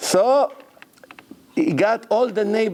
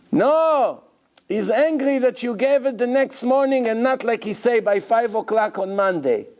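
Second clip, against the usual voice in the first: 1 upset broker; 7 semitones higher than usual. Retold or imitated. Broker explains seller's anger.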